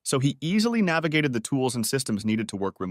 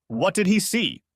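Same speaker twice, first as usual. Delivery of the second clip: ominous growl; 9 semitones higher, slower than usual. A narrator's reading. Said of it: "growled Al ominously".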